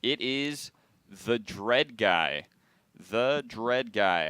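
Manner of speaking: drawn-out, very slow reveal; winner announcement. Real, not acted. Host announces the next Fight for the Crown hoodie winner, slow drawn-out reveal. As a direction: stretch the name out for suspense.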